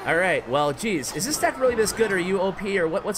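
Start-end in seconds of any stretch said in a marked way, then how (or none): 1.2-3.2 incredulous. Real, not acted. Opponent crushing, disbelief.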